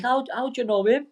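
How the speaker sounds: mock starstruck lads asking how he knows the boxer; amazed schoolboys. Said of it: "the lads were all like".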